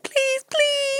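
whiny mock plea, very high; high-pitched begging. Imagining a partner begging in bed, high-pitched pleading. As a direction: beg in a silly high voice. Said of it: "saying it in that high-pitched voice".

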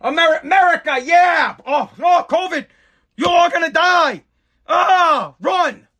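high mock scream of fear, shouted; mock panic. Ranter mocking patriots scared of covid, high shouted mock panic. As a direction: play a patriot shrieking at covid, hammed up. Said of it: "people that are just like".

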